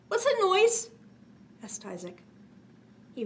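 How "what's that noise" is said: put-on startled child. Startled boy hears a noise.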